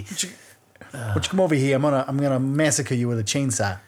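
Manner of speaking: hammed-up Boston accent, r's dropped; mock Massachusetts accent. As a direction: redo the killer's line as a Massachusetts local. Massachusetts version of the same skit.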